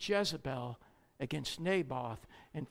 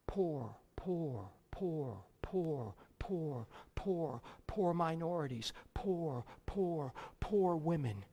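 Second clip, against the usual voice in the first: mocking repetition; 4 dB quieter, slower than usual. Mocking social justice emphasis.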